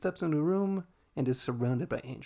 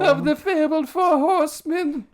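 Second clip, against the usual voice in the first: put-on high, slow German doctor accent; a hammy German doctor accent, looking down on them; 10 dB louder than usual. Pride sneering at Fury in a German doctor accent. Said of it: "I was almost going German doctor".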